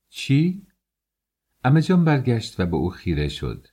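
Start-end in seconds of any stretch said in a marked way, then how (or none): 0.1-0.7 sharp high startled what; surprised. Acted. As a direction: jump in, caught off guard. Startled girl interrupts aunt. (متعجب و با صدای بلند)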